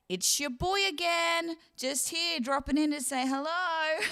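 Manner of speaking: high-pitched catchphrase, breaking into a laugh; rozbawiona parodia powiedzonka. Retold or imitated. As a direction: call out the running gag in a high mock voice. Re-enacting the trip's running joke.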